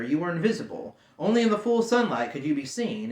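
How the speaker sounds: ordinary delivery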